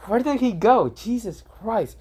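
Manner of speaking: high, exasperated searching voice; flustered Hanabi. Acted. Hanabi losing Boruto in chase.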